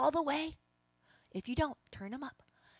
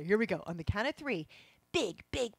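ordinary talk

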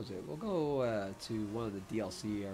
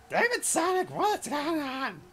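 much higher, slow, strained cartoon voice; sick Jet the Hawk; 8 dB louder than usual. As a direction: squeak it out like a sickly cartoon hawk. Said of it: "I sound like a sick Jet the Hawk".

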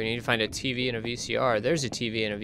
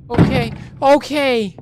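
high, loud repeated okays; high-pitched panicky yelps; rattled; 12 semitones higher, 7 dB louder, slower than usual. Real, not acted. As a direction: blurt it loudly in a high, jolted voice. Reeling from a scare.

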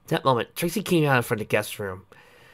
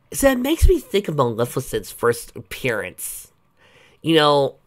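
ordinary delivery

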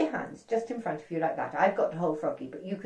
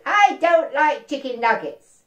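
fussy refusal; 6 semitones higher, 8 dB louder, slower than usual. Acted. Frog refusing food.

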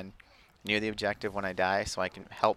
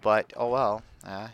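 usual speaking voice

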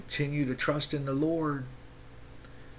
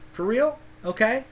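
incredulous challenge; 9 semitones higher than usual. Real, not acted. Challenges the listeners.

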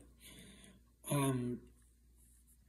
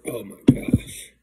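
gasping, disgusted groan; after bitter medicine; 8 semitones higher, 16 dB louder, much slower than usual. Real, not acted. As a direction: recoil from a nasty taste. Disgusted after tasting the drink.

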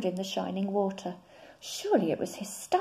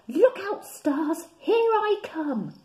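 much higher, louder, slowing at the end; excited call; 9 semitones higher, 6 dB louder, slower than usual. Acted. Frog calls out to the stars as he jumps. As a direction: cry out as you leap.

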